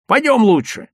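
loud, high, impatient urging; heated insistence; cross urging. A narrator's reading. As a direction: order a friend along crossly. Angry Lyovka urges his friend on.

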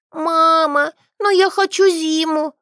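put-on high, tearful child's wail; a girl crying for winter. A narrator's reading. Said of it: "заплакала Адель". (детский плач)

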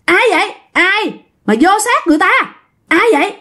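high-pitched, insistent questioning; demanding questioner. Acted. Aunt demanding to know who has entered, insistent. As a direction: fire the questions out high, in short bursts. Aunt interrogates the possessing spirit.

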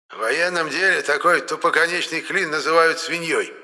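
put-on high, rattly little old man; a lightly voiced cackling geezer. A narrator's reading. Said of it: "дребезжащее хихиканье". (дребезжащий старичок)

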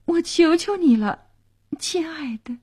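high plea fading into a whisper; pleading. A narrator's reading. (苦苦哀求，末尾转为低语)

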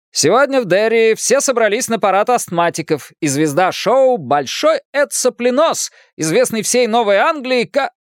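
put-on high, booming old-time newsreel voice; newsreel announcer. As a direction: announce it like a hammy newsreel host. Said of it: "заговорил голосом диктора кинохроники".

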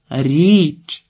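«Річ» called out with a long vowel; shouted into ear. A narrator's reading. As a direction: shout «Річ» as a correction to someone who misheard. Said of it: "крикнула Марта їй на вухо".

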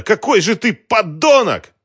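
high, loud, drawn-out rage; furious yelling. A narrator's reading. Woman attacking the man who betrayed her, enraged scream. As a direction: scream the insult, stretching it out. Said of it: "кричала она".

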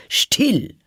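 sharp, high, loud cry for silence; sudden shout. Acted. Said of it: "rief der König".

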